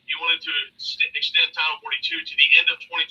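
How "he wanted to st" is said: usual speaking voice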